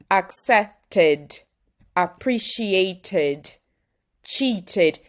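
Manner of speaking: "accepted", "appreciated", "cheated" with a full extra "ed" syllable. Shown as a demonstration. Teacher drilling the extra "ed" syllable for learners. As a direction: sound out the final "ed" as its own syllable. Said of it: "So you see, is an extra syllable".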